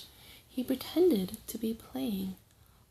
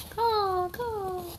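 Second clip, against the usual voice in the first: trickster tale voices; 10 semitones higher, 7 dB louder than usual. Acted. Raven becomes a bird.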